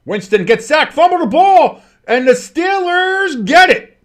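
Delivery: high, loud play-by-play yell, stretching the team name; excited shouted call. Real, not acted. Announcer calling a sudden fumble to viewers, excited shout.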